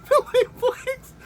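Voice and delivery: climbing into a very high, helpless squeal; laughing till lungs hurt. Real, not acted. Reviewer repeating the actor's name, helpless with laughter. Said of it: "my lungs hurt".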